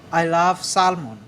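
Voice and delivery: ordinary talk, nothing marked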